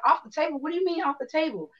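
high, quick puzzled questions; baffled echo, mock innocent; confused kids. Children puzzled by the mother's words, high and quick.

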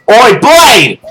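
loud call across the room. Real, not acted. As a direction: call him over sharply. Calling out to a guest.

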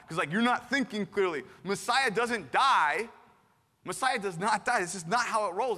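hammed-up scolding of a confused friend; indignant protest. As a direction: set him straight, insistent. Acts Peter scolding Jesus.

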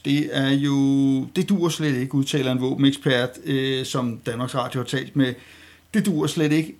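ordinary talk, nothing marked

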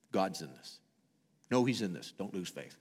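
quiet repeat that lets it sink in. Acted. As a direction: drop it to a hushed echo. Repeated softly for emphasis.

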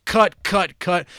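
cut, cut, cut shouted fast and high; mock shout. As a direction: bark the cuts like an impatient editor. Mocking rapid editing.

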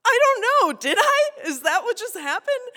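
high, loud, bewildered laughing questions; stunned, giggling reply after being bitten; shocked laughing. Retold or imitated. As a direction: laugh through the disbelief. Bitten, in shock, answering stranger.

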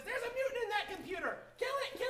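high, urgent shrieking alarm; frantic computer voice. Acted. Game master voicing the computer ordering the kill, frantic high alarm. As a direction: scream orders like a panicked machine. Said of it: "The computer turns to all of you and says".

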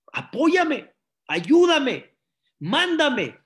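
high, loud acted begging; heartfelt tearful plea. Poor father praying to God, heartfelt plea. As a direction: build to an imploring cry, word by word. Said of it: "Empezó a lagrimear, pero de sentimiento".

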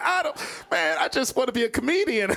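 high, whiny mock plea; acting out a flustered protest; panicked plea. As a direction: plead helplessly in a high voice. Acting his scared plea to the dudes.

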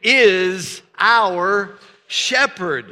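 very slow, loud, every word drawn out; drawn-out congregational chant; slow call and response. Shown as a demonstration. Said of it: "Could you say that with me?".

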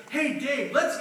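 pumped-up greeting, lightly mocked; fired-up guy. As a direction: imitate an overexcited new convert. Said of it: "he was like those fired up guys".

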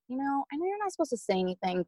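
high, softened retold request; imitated careful, apologetic asking; tentative plea.

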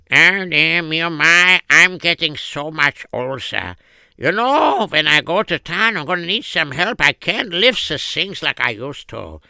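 lightly voiced old man groaning about age; old father. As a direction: complain like an aging father.

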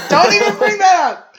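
high, loud laughing protest; shrieking laugh; laughing yell. Real, not acted. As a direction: squeal it out through laughter.

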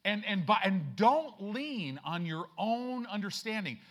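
loud, high, stretched-out preaching; full-force preaching. Acted.

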